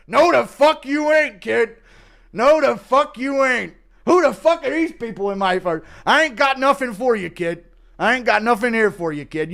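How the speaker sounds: put-on cranky old man waving a kid off; stubborn old man.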